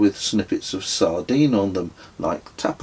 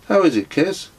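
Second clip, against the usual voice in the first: character voice; 10 semitones higher, 6 dB louder than usual. A narrator's reading. Narrator voices question to Kez.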